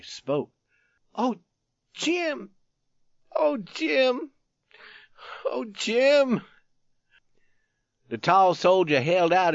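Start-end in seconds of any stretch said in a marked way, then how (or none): 1.2-6.4 high-pitched, broken exclamations; anguished cries. A narrator's reading. Youth finally speaks to dying friend, wailing. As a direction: wail it high, with pauses between.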